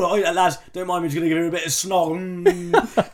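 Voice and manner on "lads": usual speaking voice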